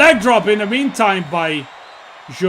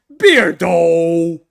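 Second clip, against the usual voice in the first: loud, drawn-out name as a telling-off; exasperated scolding; 2 semitones lower, 6 dB louder than usual. Real, not acted. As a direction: call out in exasperation, stretching the word. Scolding a teasing colleague by name.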